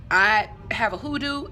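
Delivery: usual speaking voice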